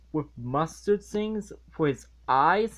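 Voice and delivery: normal talking voice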